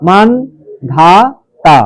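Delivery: মানধাতা very slow as man, dha, ta; long pauses between the three parts of মানধাতা; syllable by syllable. Shown as a demonstration. Teacher breaks the word down for pupils, slow and segmented. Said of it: "মান একটা হসন্ত দেবে".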